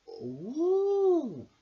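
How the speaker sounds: high tiny bee voices; a chorus of curious little bees. Acted. Little bees react to the new pupil, high and tiny. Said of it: "said the little bees".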